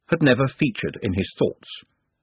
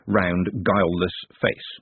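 plain reading voice

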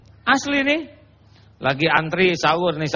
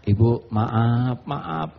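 gentle correction; 7 semitones lower, much slower than usual. Shown as a demonstration. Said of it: "Kalau urusan mengoreksi".

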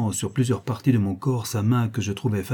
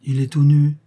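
raised, louder echo of astonishment; echoed exclamation; 4 semitones higher, 6 dB louder than usual. A narrator's reading. Observers repeating the lady's discovery, echoed exclamation. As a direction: repeat it as a scandalised group would. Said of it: "répétèrent-ils".

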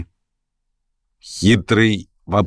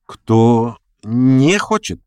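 slow, drawn-out question in altered voice; puzzled re-asking; 5 semitones higher, much slower than usual. A narrator's reading. Schoolboy querying the hostess's rule, puzzled. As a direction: check it word by word, puzzled. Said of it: "переспросил гимназист".

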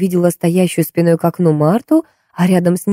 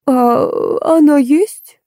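put-on businesslike old woman; 9 semitones higher, much slower than usual. A narrator's reading. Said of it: "деловито осведомилась старуха".

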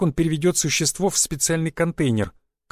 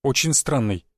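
ordinary delivery

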